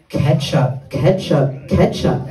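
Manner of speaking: low, insistent repeated single word. Retold or imitated. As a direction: impersonate a brother demanding by repetition. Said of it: "my brother goes like this".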